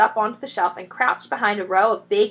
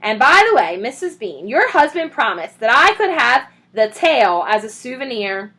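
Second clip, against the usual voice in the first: calling up; 3 semitones higher, 8 dB louder than usual. A narrator's reading. Mabel calling up stairs.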